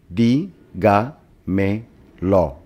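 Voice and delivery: "di, ga, me, lo" as four separate beats; syllable by syllable. Shown as a demonstration. Teacher dividing dígamelo for students, syllable by syllable. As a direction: break it up with a pause after each syllable. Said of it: "tiene cuatro sílabas y se divide así".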